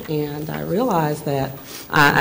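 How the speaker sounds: ordinary talk, nothing marked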